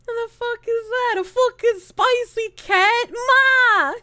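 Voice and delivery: high, slow mock outrage; Bostonian calling Ma. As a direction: build from baffled to yelling for Ma. Acts Bostonian calling to mother.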